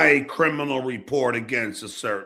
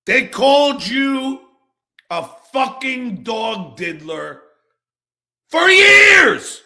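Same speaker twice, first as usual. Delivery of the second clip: furious yelling; 7 semitones higher, 5 dB louder than usual. Real, not acted. Ranting at Rob over the insults, furious shout. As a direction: voice cracking up into a shout on the end. Angry rant at former friend Rob.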